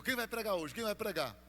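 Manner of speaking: brisk demanding question. Retold or imitated. Pastor asking the presbyter at the service, demanding. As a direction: ask sharply as the arriving pastor.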